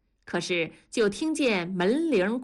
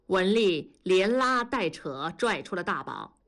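ordinary delivery, nothing marked

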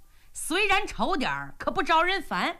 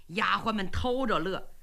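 ordinary delivery, nothing marked

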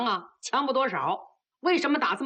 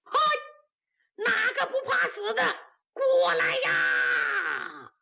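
drawn-out battlefield yell, higher; loud challenge; 10 semitones higher, slower than usual. Acted. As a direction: call out the dare, stretching it long. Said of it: "学战马高喊". (高声拖长叫阵)

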